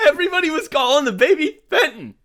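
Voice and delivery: high-pitched, helpless laughter through the words; laughing. Real, not acted. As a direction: crack up while reading it out. Cracks up reading out text.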